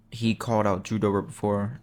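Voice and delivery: normal talking voice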